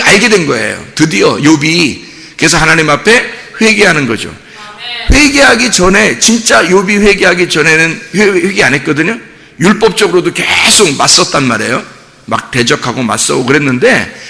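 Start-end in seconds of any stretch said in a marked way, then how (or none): none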